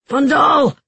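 high, loud desperate call; shout. A narrator's reading. Giels calling for the guard to answer, shouting. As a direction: yell the name out hard. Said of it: "he shouted again".

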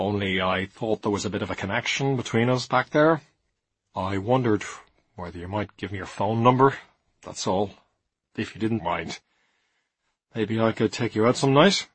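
lightly voiced hesitant, awkward suitor; embarrassed young man. A narrator's reading. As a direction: start haltingly, a little embarrassed.

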